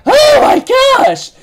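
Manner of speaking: very high, slow, loud shocked shriek; amazed shriek. Retold or imitated. King seeing Elaine's huge wings, a stunned high shriek. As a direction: stretch out a stunned high scream.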